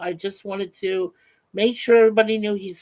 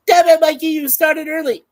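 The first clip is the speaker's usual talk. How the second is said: higher and louder, playing an annoyed friend; mock angry outburst; 9 semitones higher, 8 dB louder than usual. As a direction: bark it like a friend fed up with you. Reading friend's annoyed chat aloud.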